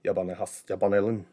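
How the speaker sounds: a light, garbled Scottish brogue; mumbled Scottish brogue. Retold or imitated. Actor imitating his hitman role in a mock Scottish brogue. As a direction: slur it like a thick-tongued Scot, lightly. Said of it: "he would become unintelligible in his brogue".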